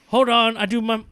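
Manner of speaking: high, boastful wrestler voice; proud Batista voice. Retold or imitated. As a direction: brag about closing the door. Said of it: "And he runs back".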